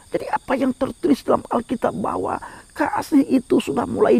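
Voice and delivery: high, urgent alarm from the pulpit; impassioned preaching. Acted. Preacher warns congregation that love grows cold, impassioned preaching.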